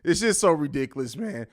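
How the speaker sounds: ordinary talk, nothing marked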